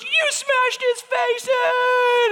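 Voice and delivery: put-on very high, slow toddler shout; Ezra. Little son amazed at the smashed mouse, imitated toddler shout. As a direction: imitate a thrilled three-year-old, drawing the words out. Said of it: "Ezra, he's three, he goes".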